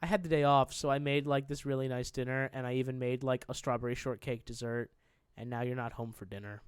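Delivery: low, quiet, sinking delivery; let down. Retold or imitated. Fiancée on the phone about missed dinner, let down.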